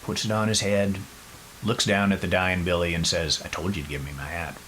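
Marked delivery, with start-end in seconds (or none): 3.4-4.6 soft, fast, icy cold; cool and calm. Retold or imitated. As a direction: say it coolly, with quiet menace. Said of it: "cool as can be".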